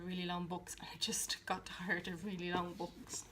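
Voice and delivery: tired whispered aside; soft voiceless grumble. Real, not acted. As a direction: whisper it, fed up with long books. Weary aside about long books.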